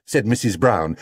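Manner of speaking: plain reading voice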